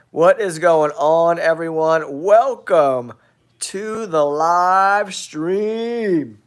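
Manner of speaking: lively greeting, stretching out the last words; big show welcome. Real, not acted. Host welcoming everyone to the live stream, big show welcome. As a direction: open the show with a drawn-out flourish.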